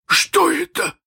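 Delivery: high, startled pirate captain. Acted. Hook asks his crew about the scream, high and startled. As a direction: ask sharply, unsettled. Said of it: "спросил Крюк".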